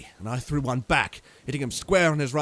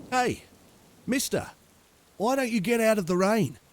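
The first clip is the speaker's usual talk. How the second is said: calling stranger; 9 semitones higher than usual. A narrator's reading. Stranger calls from awning.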